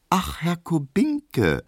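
hoch, langsam und gedehnt freundlich; begrüße ihn süßlich und gedehnt; sehr freundlich. A narrator's reading. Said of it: "meint Hedwig sehr freundlich". (Hedwig grüßt Kubinke langsam und sehr freundlich)

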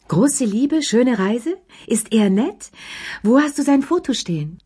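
eager prying questions. A narrator's reading. Madame Pelou pries into Lea's new love, eager and high. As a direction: pelt her with nosy questions.

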